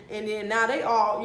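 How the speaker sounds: ordinary delivery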